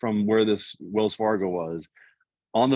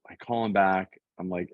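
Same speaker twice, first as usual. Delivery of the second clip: ordinary talk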